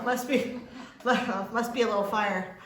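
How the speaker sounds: laughing through the words. Real, not acted. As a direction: laugh it off, tickled by your own joke. Laughs at own joke.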